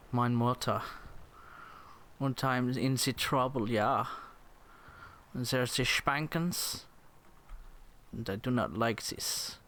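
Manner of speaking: put-on halting comic German accent; hammed-up German English; mock German accent. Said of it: "This is how German people talk".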